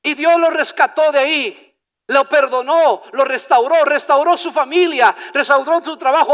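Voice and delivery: loud, punched testimony list; forceful preaching. Real, not acted. Preacher telling how God rescued the man, forceful preaching. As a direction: hammer out each act of restoration.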